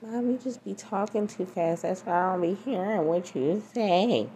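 put-on high kid voice complaining; daughter voice. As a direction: play a whiny teen daughter. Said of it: "She's like".

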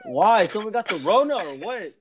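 high, loud mock outburst; imitated coworker outburst. Imitating an alarmed coworker's blurted question.

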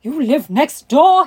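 slow, loud demanding question. A narrator's reading. Indian gentleman questions Sarah about where she lives, loud demand. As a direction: demand it at full volume, drawn out. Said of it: "he demanded".

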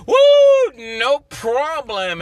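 loud high whoop, then slow; whooping cheer. Retold or imitated. Cheering himself on, a loud whoop. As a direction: cheer yourself on with a big whoop. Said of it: "and go".

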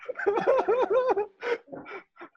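bursts out laughing; a big real laugh at the joke; hearty laugh. Real, not acted. (tertawa lepas)

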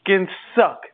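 heated fan complaining to his wife about the coach; ranting at the TV. Retold or imitated. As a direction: vent like a fan yelling at a losing team. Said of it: "I'd be like".

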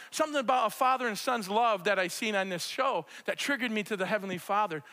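ordinary talk, nothing marked

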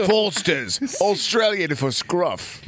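badly faked Australian twang; hammed-up Australian beer-ad delivery; Australian accent. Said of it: "I gotta work on my Australian accent".

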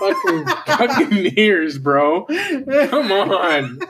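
laughing in disbelief; slow, laughing protest. Real, not acted. Mock outrage, laughing.

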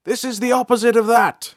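high, loud, bright character voice; smiling Bolthar. A narrator's reading. Bolthar smiling as he explains the Umbra to April. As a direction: explain it with a grin. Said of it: "he smiled".

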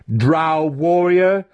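high, slow, drawn-out mocking title; chiding. A narrator's reading. Mentor throwing his student's title back, chiding. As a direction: stretch the title out in derision. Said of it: "Zack chided him".

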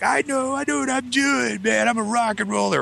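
high, cocky and laughing; mock teen bravado. Mocking his teenage self, high and laughing, cocky. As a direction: send up your teenage self playing tough. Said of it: "so I'm all, like, dramatic".

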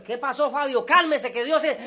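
high, loud, hurried reassurance; agitated exchange. Retold or imitated. Pastor tries to settle the jumping father, agitated and loud. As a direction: try to talk over someone's frenzy.

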